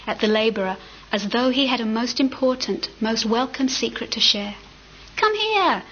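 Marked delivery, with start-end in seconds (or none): 5.2-5.8 high, light and friendly call to a stranger; easy old man's beckoning. A narrator's reading. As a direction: invite him over like a kindly grandfather. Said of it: "the old man said in easy language".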